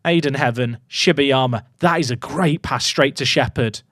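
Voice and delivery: ordinary talk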